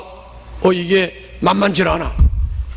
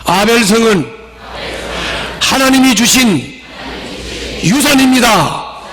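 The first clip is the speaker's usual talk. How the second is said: call for repetition; 4 semitones higher, 4 dB louder than usual. Shown as a demonstration. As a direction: proclaim it for the congregation to echo. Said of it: "자, 따라해봐요". (따라 하도록 크고 또박또박 선창)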